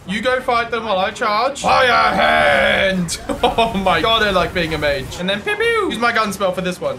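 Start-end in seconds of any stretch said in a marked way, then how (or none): 1.6-3.2 loud, drawn-out battle cry; shouted spell call. Pretend. Player casting a charged fire spell, loud shout. 5.4-5.9 quick high pew noises; mimicked gun shots. Player enjoying being a mage, high pews.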